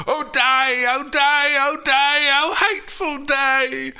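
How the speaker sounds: high, loud, wailing lament; hammed-up cry of grief repeated over and over; wailing nurse. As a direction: wail it out like a grieving old nurse. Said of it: "The nurse's repetitions".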